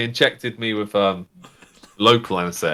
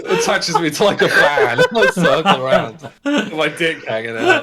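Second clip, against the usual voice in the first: higher, words breaking up with laughter; giggly, barely keeping it together; laughing while talking; 6 semitones higher than usual. Real, not acted. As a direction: crack up while telling the joke. Joking through laughter about exposure.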